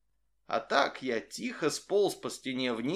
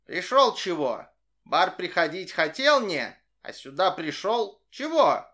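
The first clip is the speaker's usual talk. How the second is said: higher, louder squeaky animal voice; squeaky monkey voice; 4 semitones higher, 6 dB louder than usual. A narrator's reading. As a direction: squeak it out, pushy and puzzled. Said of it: "писклявым голосом". (писклявый голос обезьяны)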